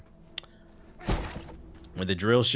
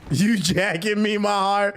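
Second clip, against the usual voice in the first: higher, slow, drawn-out mock come-on; hammed-up New York line; 11 semitones higher, 3 dB louder, slower than usual. The streamer re-enacting the slang line to chat, hammed-up and higher. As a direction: stretch it out like a mock sweet-talker. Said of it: "I be like, "Yo, pause".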